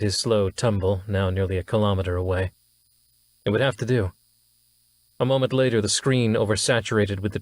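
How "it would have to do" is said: ordinary delivery, nothing marked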